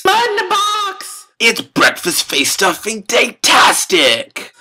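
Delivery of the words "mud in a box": very high shouted mascot cry; cereal mascot. Acted. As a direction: shriek the slogan like a mascot. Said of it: "mascot shout".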